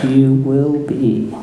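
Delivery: Yoda voice. A narrator's reading. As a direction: weigh each word, stress on You. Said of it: "said Yoda".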